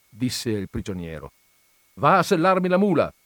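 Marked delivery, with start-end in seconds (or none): 1.9-3.1 loud, high, brisk command; commanding call; giving an order. A narrator's reading. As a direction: order the farmhand off at once. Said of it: "ordinò il contadino".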